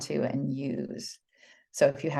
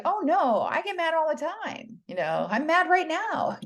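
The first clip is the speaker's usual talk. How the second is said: much higher, playful denial; lively protest; 12 semitones higher than usual. Retold or imitated. Teacher answers pupils who think she never gets mad, playful high protest. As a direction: cheerfully insist you do get mad. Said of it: "I was always like".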